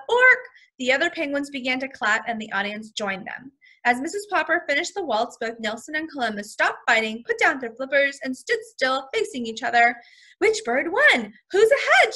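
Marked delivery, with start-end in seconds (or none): 0.1-0.4 high, loud penguin yelp; loud ork. A narrator's reading. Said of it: "retreated with a loud". 10.4-12.1 high, loud crowd shout; shouting audience. A narrator's reading. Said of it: "shouted the audience".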